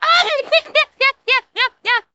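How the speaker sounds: loud squealing laugh; high shrieking laugh. Real, not acted. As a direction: burst out laughing shrilly. Laughing at follower drop.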